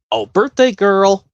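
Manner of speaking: a hint of a kids' show host. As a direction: greet the birthday girl like a TV host. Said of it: "do an impression from a Blue's Clues episode".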